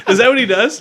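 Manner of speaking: loud, high burst of laughter; laughing hard. Real, not acted. Host cracking up at the guest joke, loud laughter.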